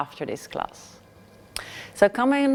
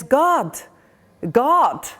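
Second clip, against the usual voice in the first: high, louder amazed calling out; 6 semitones higher, 3 dB louder than usual. Acted. Pilgrim realises God answered, high louder amazed calls. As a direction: call out in wonder and hope.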